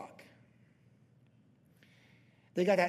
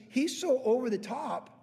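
usual speaking voice

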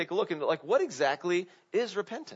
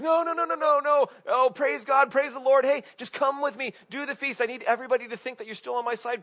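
much higher, frantic begging; high, louder desperate backpedalling; panicked pleading; 8 semitones higher, 4 dB louder than usual. Retold or imitated. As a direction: plead like a king about to lose everything. Saul begging Samuel to stay.

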